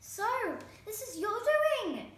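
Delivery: high, loud stepmother scream; screaming accusation. A narrator's reading. Stepmother shrieks at Herb for the mess. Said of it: "she screamed".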